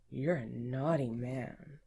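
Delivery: usual speaking voice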